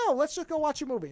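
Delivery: high-pitched mild innocent refusal; virtuous innocent. Retold or imitated. Man turning down her advances, lightly voiced innocent. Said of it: "angling him as a virtuous innocent".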